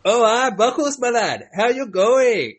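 high, loud, drawn-out mock British accent; badly faked UK accent, slow and high-pitched. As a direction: put on a hammed-up British contestant's accent. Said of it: "that's how they speak".

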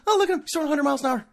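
high, fast, fake-wowed gasp; mock amazement. Sarcastic fan marveling at Verlander's speed, mock amazement.

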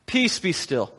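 softly spoken calm rebuke; calm command. Retold or imitated. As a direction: settle the storm with gentle authority. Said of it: "was like".

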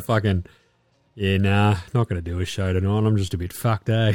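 low, spent mumble; bow out, dead tired; retold end-of-day weariness; wiped-out resignation. Retold exhaustion after long day.

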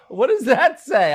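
slow, laughing through the words. Real, not acted. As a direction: ask it while still cracking up. Still laughing while asking.

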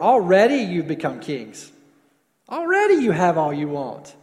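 loud, mildly sarcastic apostle. Retold or imitated. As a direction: voice Paul's sarcasm, leaning hard on ALREADY. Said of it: "give some apostolic sarcasm to him".

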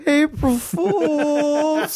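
high, very stretched-out singsong; sheepish prank reveal. Retold or imitated. Feeble prank reveal to crying sister.

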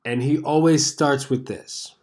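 ordinary talk

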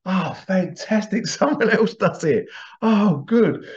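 high, excited, laughing; high delighted inner voice with a laugh. Retold or imitated. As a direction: gush with delight that someone else does it. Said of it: "And I thought".

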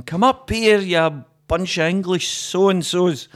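taunting Highlander. Acted. Highlander on a hilltop to English soldiers, taunting.

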